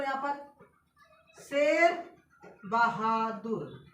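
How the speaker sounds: slow drawn-out. Shown as a demonstration. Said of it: "यहाँ पर देखो एक और नाम देख लो".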